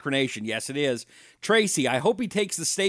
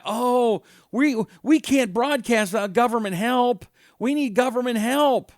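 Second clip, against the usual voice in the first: mock whining; 8 semitones higher, 4 dB louder than usual. Mocking public broadcasters asking for funds.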